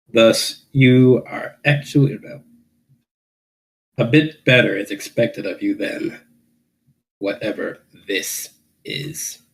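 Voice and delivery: ordinary talk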